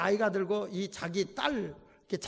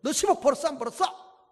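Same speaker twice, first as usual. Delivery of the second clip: demanding mother; 7 semitones higher, 6 dB louder than usual. Retold or imitated. Mother confronting daughter.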